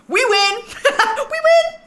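high, loud victory shout; triumphant cheer. Real, not acted. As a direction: cheer like a winner. Celebrating baby girl.

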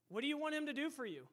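whole question in one fast, unbroken run; quick repeated question. Real, not acted. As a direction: ask it briskly without pauses, rising through the middle.

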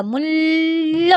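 মূল্য drawn out very slowly, the ল doubled, mullo; slow doubled demo. Shown as a demonstration. Teacher to students, slowly sounding out মূল্য's doubled ল. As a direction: stretch মূল্য with a long double ল. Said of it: "সেটাকে আমি double করে উচ্চারণ করবো".

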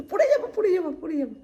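high, loud urgent repeats; real high-pitched alarm; alarmed warning. Real, not acted. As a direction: cry out a quick warning of a fall. Warns pet of falling.

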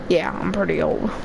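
put-on low, subdued old-rabbit voice; Carrot McCool. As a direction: drop the pitch for an older rabbit. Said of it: "I got a voice change".

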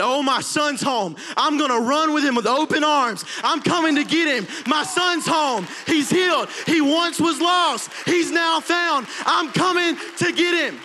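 high, loud, joyful cry of a father; joyful father shouting. Acted. Father spotting his lost son, calling out in loud joy. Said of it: "and he said".

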